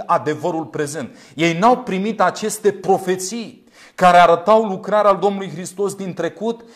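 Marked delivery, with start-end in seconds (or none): none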